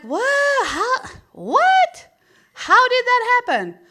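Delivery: high, loud, shocked shout; shocked disbelief. Retold or imitated. Mother finding her son covered in poop, high shocked shout. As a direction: yelp it out in total disbelief.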